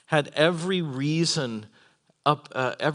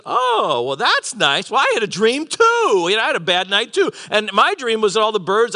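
higher, louder, hammed-up enthusiasm; eager baker; 6 semitones higher, 4 dB louder than usual. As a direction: play a hopeful baker jumping in. Eager baker hearing butler's good news.

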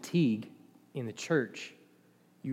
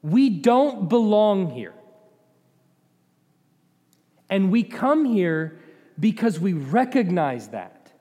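higher, louder, drawn-out preaching; emphatic preaching; 7 semitones higher, 7 dB louder than usual. Real, not acted. Preacher telling the congregation why they worship, emphatic and loud. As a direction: preach it out forcefully, word by weighty word.

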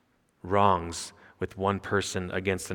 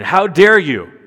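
"How dare you?" much louder and higher; emphatic rebuke; 8 semitones higher, 11 dB louder, slower than usual. Shown as a demonstration. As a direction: thunder it at the congregation. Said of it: "a couple things get your attention".

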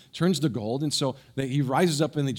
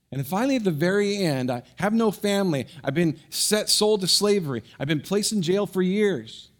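high, loud, impassioned telling; building preaching; 5 semitones higher, 3 dB louder than usual. Acted. Pastor building to Joseph's reunion, impassioned. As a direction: lift the room toward the climax.

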